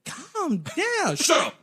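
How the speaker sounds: husband hushing wife, high and meek; mock timid reply. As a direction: squeak it out like a scared husband. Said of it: "I'm like".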